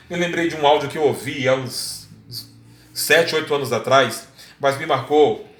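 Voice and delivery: ordinary talk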